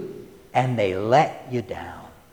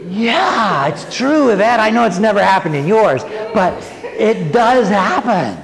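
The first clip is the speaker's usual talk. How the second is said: higher and louder, a chuckle at the end; playful teasing; 7 semitones higher, 4 dB louder than usual. Real, not acted. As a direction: tease her gently about her own family. Teasing a congregant named Yvette.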